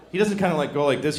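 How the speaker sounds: ordinary delivery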